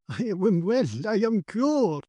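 high, drawn-out exultant cry; joyful cry. A narrator's reading. Cured paralytic crying out with tears, exultant high cry. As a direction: cry it out slowly in swooping wonder. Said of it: "he cried".

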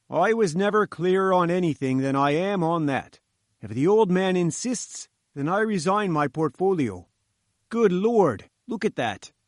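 stubborn refusal that breaks into alarm; lightly voiced engineer. Engineer refuses the professor's order, then sees it move, alarmed.

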